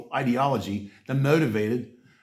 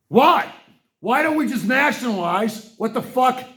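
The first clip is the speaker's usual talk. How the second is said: higher and louder burst; angry outburst; 9 semitones higher, 3 dB louder than usual. Real, not acted. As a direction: erupt in exasperation. Sudden outburst in rant.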